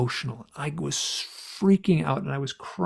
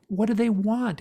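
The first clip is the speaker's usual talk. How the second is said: higher questioning voice; higher mimic of the hypnotist; Yvonne asking; 8 semitones higher than usual. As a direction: ask gently but pointedly. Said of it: "And they're like".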